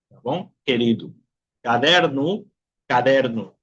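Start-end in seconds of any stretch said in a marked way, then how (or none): 0.6-1.1 querido ending in u; final o as u. Shown as a demonstration. Teacher models querido with final u. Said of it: "puedo pronunciar como". 1.6-3.6 caderno ending in u; final o as u. Shown as a demonstration. Teacher models caderno with final u. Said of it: "puedo pronunciar como".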